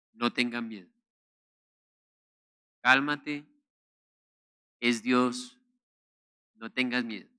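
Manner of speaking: Jesus calming his scared disciples in the storm; calm reassurance. Acted. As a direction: settle a frightened friend gently.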